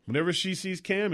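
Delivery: ordinary delivery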